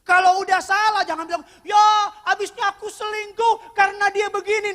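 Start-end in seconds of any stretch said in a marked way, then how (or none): 1.6-4.7 loud, high-pitched protest; mock excuse. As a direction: belt out the lame excuse. Mocking an excuse for cheating.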